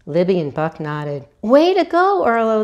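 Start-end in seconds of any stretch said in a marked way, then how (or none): 1.4-2.6 much higher, louder cheer. A narrator's reading. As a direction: cheer the birthday boy on. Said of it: "they cheered".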